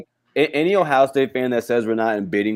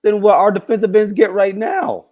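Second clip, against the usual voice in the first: higher and louder, incredulous; pitched up in disbelief; outraged; 8 semitones higher, 5 dB louder than usual. Real, not acted. Outraged over contract demand.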